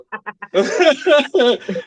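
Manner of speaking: high burst of real laughter. Real, not acted. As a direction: laugh it out high before going on. Laughs recalling her entrance.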